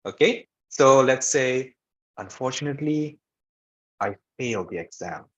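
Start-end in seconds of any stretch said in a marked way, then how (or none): 2.2-5.2 sad low voice. Shown as a demonstration. Teacher giving an example of bad news, sad and low. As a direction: let it sink low and soft by the end. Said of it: "when you want to present something".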